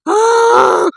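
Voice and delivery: high, loud fake sobbing gasp; mock crocodile sob-gasp. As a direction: gasp like a kid crying crocodile tears. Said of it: "मगरमच्छ वाले आंसू।". (ऊँची, ज़ोरदार नकली सिसकी)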